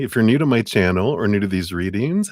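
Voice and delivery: ordinary delivery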